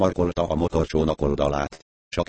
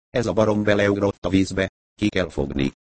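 ordinary delivery

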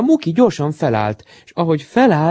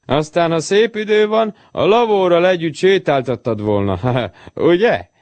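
high-pitched, lightly teasing jeer; gentle sing-song mockery, pitched up; mocking; 5 semitones higher than usual. A narrator's reading. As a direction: tease your friend, ending on a chuckle. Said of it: "Csúfolódott Jócsont".

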